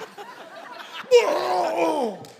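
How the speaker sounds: hammed-up, high falling retch; mock vomiting. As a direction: heave an exaggerated puking noise. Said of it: "she should be vomiting".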